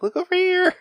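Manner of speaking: sing-song to pull her eyes away; mock distraction. Dad distracting his scared daughter with a high voice. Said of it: "trying to distract her".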